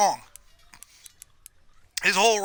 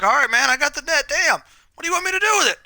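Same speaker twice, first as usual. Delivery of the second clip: higher pitch climbing through the line; exasperated retort; 9 semitones higher, 4 dB louder than usual. Retold or imitated. Snapping back at buddy demanding the net, exasperated. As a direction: act out a fed-up comeback, voice rising.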